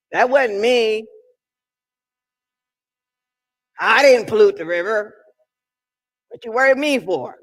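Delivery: rising preacher's cadence, pushing hard; fervent sermon delivery; preaching at full force. Acted. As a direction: preach it to the congregation.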